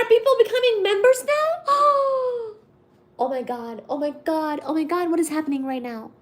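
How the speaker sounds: normal talking voice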